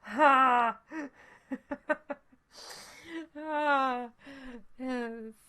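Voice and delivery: ordinary delivery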